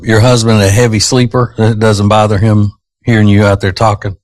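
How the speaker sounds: normal talking voice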